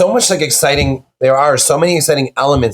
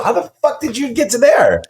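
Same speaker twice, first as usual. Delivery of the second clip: incredulous, pitch shooting way up; real astonishment; 11 semitones higher than usual. Real, not acted. Guest stunned by the host's insight, amazed. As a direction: marvel at an uncanny guess, high-pitched.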